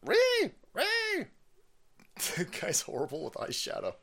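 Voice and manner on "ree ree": short, very high-pitched "ree" squeal; mock screech. Host mocking outraged people, high screech.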